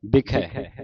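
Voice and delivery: ਬਿਖੈ with wide final vowel; open ai ending. Shown as a demonstration. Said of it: "ਦੋ ਦਲਾਵਾਂ".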